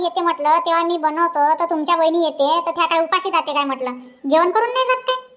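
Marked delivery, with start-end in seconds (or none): 4.3-5.3 indignant retort. Acted. Wife quarrels over sister's visit.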